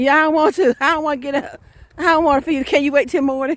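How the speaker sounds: high, loud whining complaint; whiny tired mother. Acted. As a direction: plead with the baby to wait. Tired mother begs crying baby.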